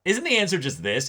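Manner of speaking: playing the dim friend suddenly brilliant; mock genius realization. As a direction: toss it off like it's obvious. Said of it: "where he's like".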